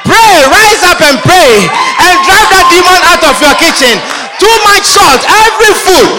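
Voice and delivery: fiery sermon cry; full-force preaching. Real, not acted. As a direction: rouse the congregation to pray.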